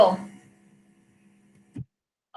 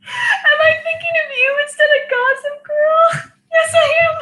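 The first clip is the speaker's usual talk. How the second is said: laughing hysterically; 12 semitones higher than usual. Real, not acted. Drunk embarrassed laughing fit.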